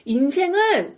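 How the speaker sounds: high, loud acted outburst; imitated shout of bitter protest; sudden yell. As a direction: cry it out, dragging the last word. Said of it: "갑자기 소리를 지릅니다".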